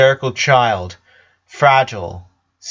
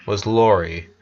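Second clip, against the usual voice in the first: ordinary delivery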